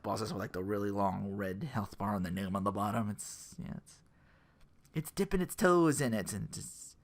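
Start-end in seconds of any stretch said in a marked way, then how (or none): none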